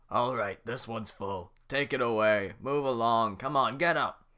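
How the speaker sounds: loud bossy guard voice; barking guard. Acted. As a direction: order the prisoners around. Guard ordering creatures.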